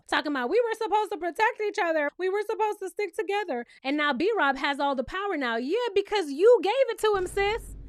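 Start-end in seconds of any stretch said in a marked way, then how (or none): 0.5-3.7 higher-pitched imitation of a tearful complaint; lightly mock someone crying over betrayal; mock tearful whine. Recapper mocking Danielle crying in confessional, tearful whine. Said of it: "don't get in your confessional crying now".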